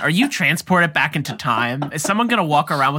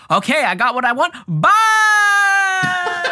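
higher and louder, climbing to a laughing shout on bye; mock carefree getaway, bursting into a laughing yell; cheeky hijacker bailing out; 4 dB louder, slower than usual. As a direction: gleefully sign off like a hijacker leaping out of the plane. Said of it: "of being like".

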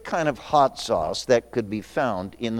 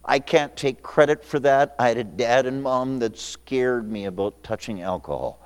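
normal talking voice

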